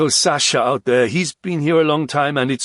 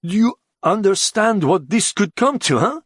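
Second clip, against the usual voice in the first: high, urgent questioning; alarmed, insistent demand; agitated; 9 semitones higher, 4 dB louder than usual. A narrator's reading. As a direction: press it urgently, pitched up. Upset man presses his question.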